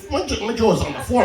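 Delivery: ordinary talk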